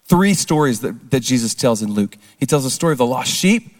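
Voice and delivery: ordinary talk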